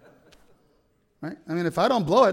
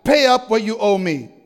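loud, raised demand for payment; crying out; 5 semitones higher, 7 dB louder than usual. A narrator's reading. Servant demanding his shillings back, loud cry.

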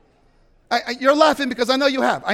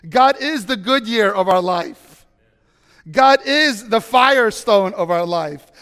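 slow, louder emphatic preaching; preaching at full force; 5 dB louder than usual. Acted. Pastor to his congregation, slow and emphatic. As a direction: hammer each line home slowly.

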